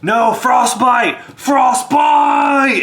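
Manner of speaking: high, loud desperate cries; dwarf pulled by a demon screams for Frostbite; terrified dwarf screaming. Acted. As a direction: scream for help while being dragged. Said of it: "And the dwarf tries like".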